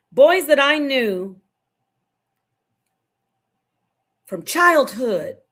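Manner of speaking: slow, loud, choked-up emphasis; forcing the words out through emotion; emotional. Real, not acted. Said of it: "I'm getting emotional".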